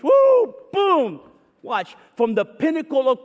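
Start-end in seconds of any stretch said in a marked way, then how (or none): 0.0-1.2 very high, loud whoop; excited whoop. Acted. Preacher to congregation, a loud excited whoop. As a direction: burst out like a celebration. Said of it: "Now watch homeboy change".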